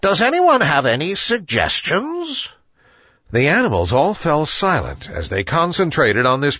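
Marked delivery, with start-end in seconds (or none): 0.0-2.5 higher, hooting owl voice with authority; a wise old bird laying out the agenda; authoritative owl. A narrator's reading. Owl chairing the meeting, higher and authoritative. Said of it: "He hooted with much authority".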